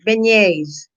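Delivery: "Beignets" said ben-YAYZ; corrected pronunciation. Real, not acted. Streamer confirms what the donuts are called, corrected pronunciation. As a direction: confirm "Beignets" with a yay sound before the final z. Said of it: "That's what it is".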